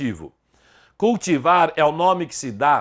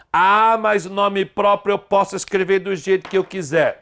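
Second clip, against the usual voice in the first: mock student objection; 4 semitones higher than usual. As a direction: argue back like a stubborn student. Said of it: "Não pode".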